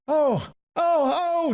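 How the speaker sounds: very high, loud wailing ohs; inarticulate cries. A narrator's reading. Woman testifying inarticulately as the being nears, high wailing cries. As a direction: let out high, wordless exclamations. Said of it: "Joanna inarticulately testified".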